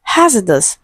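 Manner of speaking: "Hazardous" with strong HAZ and weak -uh-dus; stress on first syllable. Shown as a demonstration. Teacher models weak sounds for students, "Hazardous" stressed on HAZ. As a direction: punch HAZ in "Hazardous", reduce the other syllables. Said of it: "it is stressed on the first syllable".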